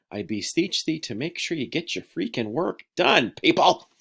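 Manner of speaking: hammed-up begging that bursts out at the end; mock beseeching. Mock plea demonstrating beseech to students.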